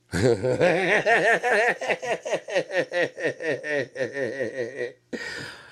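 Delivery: long high rising laughter. Real, not acted. Long laugh after acting.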